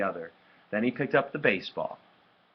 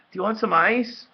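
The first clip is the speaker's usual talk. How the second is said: ordinary delivery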